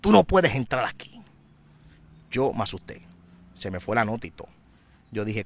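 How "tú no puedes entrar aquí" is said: sudden high, loud shout of refusal, then slower; retold shout. Santero yelling at the boy at his gate, high loud shout. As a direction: bark the no, then hold the line slowly. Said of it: "y me gritó".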